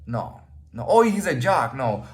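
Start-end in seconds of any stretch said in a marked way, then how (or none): 0.9-1.8 higher-pitched mock guess; mimicked snap judgement. People wrongly labelling him a jock, mock guessing. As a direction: toss out a label in an imitated higher voice.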